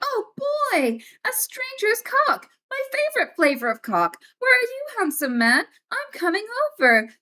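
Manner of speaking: sarcastic high-pitched mock delight; mock delighted woman. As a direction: ham up a swooning woman who loves dick pics. Mocking woman welcoming dick pics.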